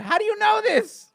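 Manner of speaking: high, shouted disbelief; loud incredulity. Real, not acted. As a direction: blurt it out in loud astonishment. Mock outrage at co-host's menu knowledge.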